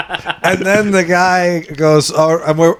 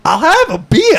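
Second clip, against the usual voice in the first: grandmother voice; 13 semitones higher, 3 dB louder than usual. Retold or imitated. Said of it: "And she's like".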